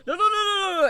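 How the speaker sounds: loud, high mock panic; panicking. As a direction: imitate a friend frantically denying it. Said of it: "then she was panicking".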